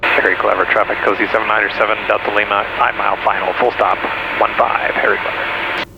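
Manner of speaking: low, level radio-call voice; pilot radio call. Real, not acted. Pilot reporting inbound to Harry Clever traffic, low radio-call voice. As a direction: announce your position in a low pilot's monotone.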